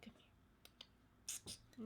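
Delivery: whispering. Real, not acted. Coaxing the pet.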